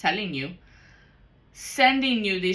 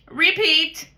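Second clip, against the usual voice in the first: loud mock command; 9 semitones higher, 10 dB louder than usual. Playing on a loop.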